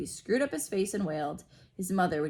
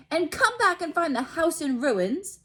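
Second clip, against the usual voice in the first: high, louder snarling aunt; 10 semitones higher, 6 dB louder than usual. A narrator's reading. Aunt Petunia snarling about Harry, high angry voice. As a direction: spit the words at the boy. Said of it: "she snarled".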